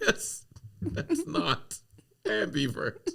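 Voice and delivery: high laughing correction, mocking a friend. Friends scoffing at her ambivert claim, high and laughing. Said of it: "they're like".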